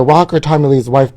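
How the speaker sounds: usual speaking voice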